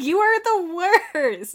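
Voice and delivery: exasperated. Acted. Scolding game character.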